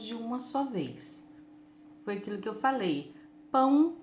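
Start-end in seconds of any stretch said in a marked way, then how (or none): 3.5-4.0 "Pão" as one loud, higher beat; one syllable. Shown as a demonstration. Teacher recaps one syllable, "Pão" loud in a single piece. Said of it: "eu falei de uma, uma vez só".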